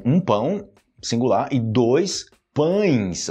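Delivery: "pão" and "pães" said slowly; slow singular, plural. Shown as a demonstration. Teacher slowly contrasting singular and plural bread. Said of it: "No plural".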